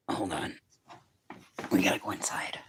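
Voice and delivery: whispered under the breath. Real, not acted. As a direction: breathe it out without voice. Whispering aside.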